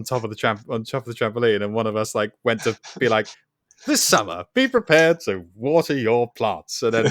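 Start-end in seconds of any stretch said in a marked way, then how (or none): 3.9-6.8 slow, put-on TV presenter delivery; mock gardening presenter. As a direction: pretend to front a gardening segment, spacing it out. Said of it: "a gardening segment".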